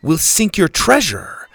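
high, loud defiant yell; girl defying the captain. Acted. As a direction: shout back at the pirate. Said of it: "Susie yelled".

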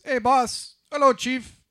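loud, high clerk's greeting; liquor store clerk. Acted. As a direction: call out like a counterman hailing a customer. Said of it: "the guy behind the counter says".

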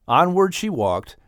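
ordinary delivery, nothing marked

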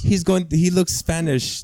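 imitating a delighted abuela; proud abuela. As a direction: gush like a proud Puerto Rican grandma. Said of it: "my abuela ... She'd be like".

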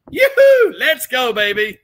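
very high, loud celebratory cheer; excited whoop. Acted. Streamer cheering a viewer on to the pub, excited whoop. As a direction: whoop it out like a party starting.

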